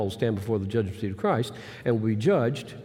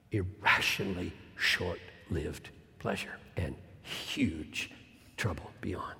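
hushed warning; 4 semitones lower, 8 dB quieter than usual. Acted. Solemn warning fading away.

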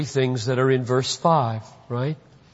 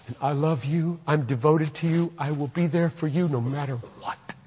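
loving father; 4 dB quieter than usual. Acted. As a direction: pledge devotion gently to your child. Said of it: "Because I'm a father".

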